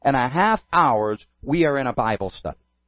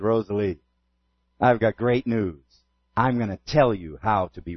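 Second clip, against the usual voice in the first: smiling; 7 semitones lower, 3 dB quieter than usual. Retold or imitated. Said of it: "And I smiled".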